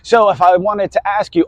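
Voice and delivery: ordinary talk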